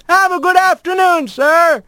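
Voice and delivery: high, chirpy bird voice; bright little mail sparrow; postbird voice. A narrator's reading. Postbird character voice.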